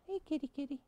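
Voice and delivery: calling kitty. Retold or imitated. Steve at home calling his cats, in a high soft coo. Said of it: "feeds the cats".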